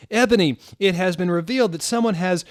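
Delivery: high, loud, fast announcing; urgent announcement. Acted. Dumbledore bursting in to announce a revelation. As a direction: blurt out the news.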